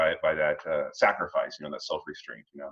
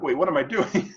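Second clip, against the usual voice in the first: mock realization; 9 semitones higher than usual. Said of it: "And I'm like".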